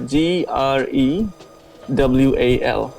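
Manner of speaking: slow letter by letter spelling; spelled out slowly. Shown as a demonstration. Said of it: "my last name is [name]".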